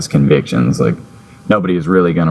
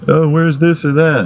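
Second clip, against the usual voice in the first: put-on kid voice; 9 semitones higher than usual. Kevin quoted in story.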